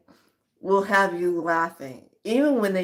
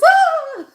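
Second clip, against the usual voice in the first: shrill high hammed-up shriek, much louder; short over-the-top horror yelp; mock scream; 12 dB louder than usual. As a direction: scream like a fifties movie victim. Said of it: "She goes".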